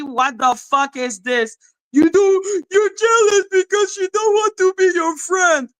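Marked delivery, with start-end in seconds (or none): 1.9-5.7 mocking whiny shout. Vlogger mocking her online haters, shrill whiny mock yell. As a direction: sneer it back at them like a crying brat.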